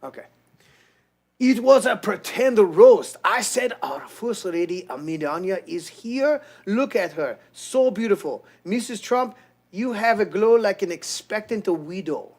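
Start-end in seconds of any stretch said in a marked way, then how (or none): 1.4-12.3 higher-pitched mock Japanese accent; put-on Japanese accent, swinging high. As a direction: read it as a hammed-up Japanese man. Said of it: "Japanese guy".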